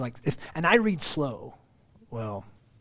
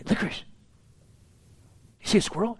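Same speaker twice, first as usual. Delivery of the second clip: a little higher, coaxing voice to a dog; teasing the dog; 7 semitones higher, much faster than usual. Retold or imitated. Pastor teasing his in-laws' dog, lightly coaxing. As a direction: tease the dog into looking. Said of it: "I would say".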